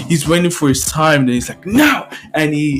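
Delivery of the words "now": high, sudden command; sharp high-pitched cue; urgent call. Acted. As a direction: give the go signal. Hero calls the signal.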